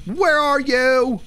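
high, slow ghost-hunting holler; high drawn-out call. Pretend. Player seeking the ghost by spirit box, high drawn-out call. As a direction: draw each word out up high.

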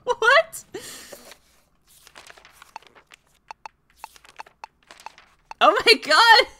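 high laughing squeals of delight; giddy laughter; laughing excitedly. Real, not acted. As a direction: laugh through the disbelief. Finds a French fry costume.

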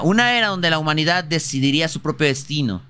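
ordinary talk

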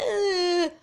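high, laughing fake cry; a hammed-up whimper with a laugh; mock sob. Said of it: "I'm not crying. I'm not like".

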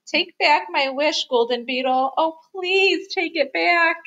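high, begging mouse voice; high storybook wail of entreaty; pleading. A narrator's reading. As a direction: beg desperately, dragging out the last plea. Mouse begs to undo wish.

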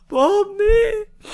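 high crying cry of loss; mock wail. Surgeon losing patient Bob, hammed-up crying wail. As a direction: wail his name in hammed-up grief.

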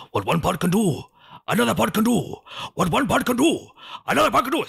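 put-on sing-song high Welsh accent. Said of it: "Now that's how you do a Welsh accent".

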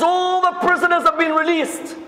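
high-pitched urgent announcement; alarmed report. Retold or imitated. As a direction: break the bad news in a high, acted alarm. Guards report the prisoners' escape.

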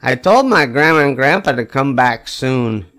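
plain reading voice

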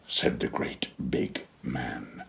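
plain reading voice

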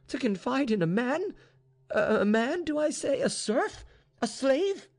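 high, halting indignation; appalled cry. A narrator's reading. Mistress balks at trusting a serf, appalled and high-pitched. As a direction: exclaim each phrase in rising disbelief. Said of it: "cried Vaninka".

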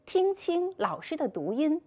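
ordinary delivery

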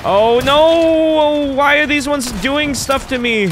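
high, loud alarmed outburst; real panic; panicked cry. Real, not acted. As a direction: panic as they all attack. Swarmed by illusions.

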